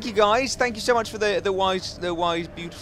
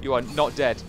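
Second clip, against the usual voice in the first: ordinary talk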